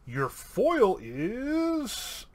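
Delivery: high, long-stretched IS; suspenseful reveal. Real, not acted. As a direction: keep them waiting on a drawn-out last word. Suspenseful card reveal to patron.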